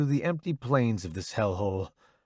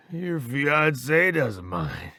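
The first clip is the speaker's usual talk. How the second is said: slow, weakened teasing; drained, strained quip; lacking pep; 2 semitones higher, much slower than usual. A narrator's reading. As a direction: drag out a joke with little strength left. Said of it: "There isn't the usual pep in his voice".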